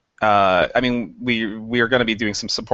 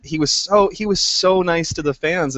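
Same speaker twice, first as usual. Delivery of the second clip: ordinary delivery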